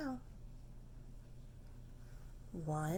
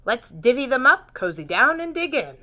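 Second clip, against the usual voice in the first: badger voice; 3 semitones higher, 11 dB louder than usual. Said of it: "Badger says".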